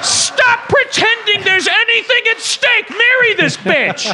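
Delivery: high-pitched shouted demand; exasperated rant. Acted. Telling Santa to stop stalling, exasperated yell. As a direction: plead angrily at the top of the voice. Ranting at the film's hero.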